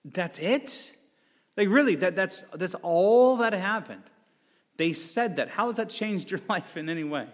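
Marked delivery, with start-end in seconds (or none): 0.1-4.0 higher, louder disbelieving parent; slow, incredulous "that's it?"; exasperated parent. Retold or imitated. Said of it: "And you go".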